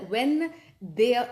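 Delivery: usual speaking voice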